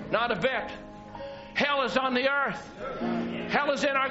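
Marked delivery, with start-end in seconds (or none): none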